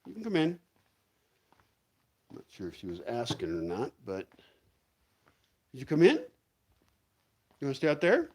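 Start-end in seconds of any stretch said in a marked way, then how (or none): 0.1-0.6 high, coaxing voice to a pet; higher sing-song pet talk; coaxing the dog. Real, not acted. 5.7-8.3 high, coaxing voice to a pet; higher sing-song pet talk; coaxing the dog. Real, not acted. Asking the small dog.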